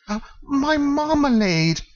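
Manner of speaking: very high, slow cry of relief; thankful exclaim. Acted. Paddington greets the fallen jar, high relieved cry. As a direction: gasp out the joy of finding it. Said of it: "he exclaimed thankfully".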